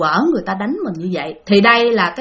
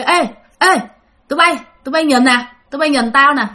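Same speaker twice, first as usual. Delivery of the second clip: calling out; 4 semitones higher than usual. Retold or imitated. Mason calls coworkers to look.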